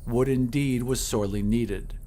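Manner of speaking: plain reading voice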